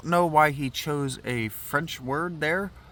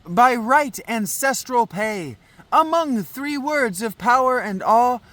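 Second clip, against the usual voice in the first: higher, emphatic ceremonial recitation; declaimed verse; 12 semitones higher, 6 dB louder, slower than usual. Acted. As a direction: proclaim it as sacred law.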